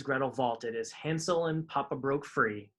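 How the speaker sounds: ordinary delivery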